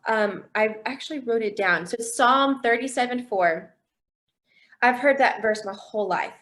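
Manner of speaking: usual speaking voice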